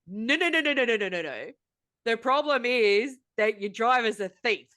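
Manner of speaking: high run of no's, sliding down; emphatic rejection. Real, not acted. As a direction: cut off the excuse with a descending string of no's. Rejects the company's written excuse.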